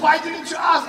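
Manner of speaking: loud, high, scolding yell; exasperated shout. Retold or imitated. In the car, brother yells at him: exasperated shout. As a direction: snap at your little brother for not asking.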